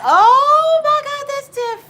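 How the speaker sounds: very high, loud, slow squeal; excited scream. Retold or imitated. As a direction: shriek it in delight. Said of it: "I said".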